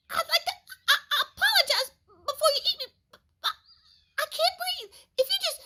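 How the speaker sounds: high, fast breathless squirrel stammer; squeaky squirrel pleading with nervous laughs; panicked squirrel. Acted. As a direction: stammer out of breath under the paw. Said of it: "had to think fast".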